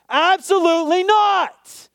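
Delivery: loud, high, slow and emphatic; emphatic shout. Acted. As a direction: belt it out with full conviction. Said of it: "It's sort of emphatic".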